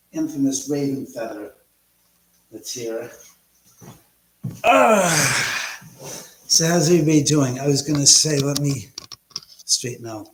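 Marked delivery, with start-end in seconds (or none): 4.6-5.8 high, loud falling "aah"; loud exclaimed "ah". Real, not acted. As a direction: let out a big high sigh. Wordless loud outburst while setting up.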